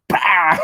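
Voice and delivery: high, loud imitated smack; mock slap noise. Imagining the Pope hauling back, loud imitated slap noise. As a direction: burst it out like a big wind-up slap landing. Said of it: "hauled back and just went".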